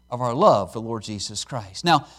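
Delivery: usual speaking voice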